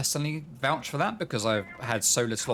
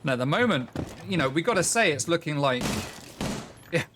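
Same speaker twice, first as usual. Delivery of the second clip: energetic esports caster calling the action; brisk match commentary; live play-by-play; 3 semitones higher than usual. Acted. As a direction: call the round as it unfolds. Caster calls the round.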